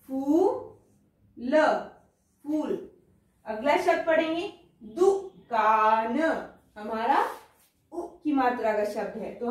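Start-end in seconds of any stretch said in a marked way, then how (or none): 0.1-1.6 फूल stretched slowly, long ऊ held; long drawn-out vowel. Shown as a demonstration. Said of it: "long sound". 4.9-6.2 drawn out slowly, syllable by syllable; slow sounding out. Shown as a demonstration. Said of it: "teacher sounds out word".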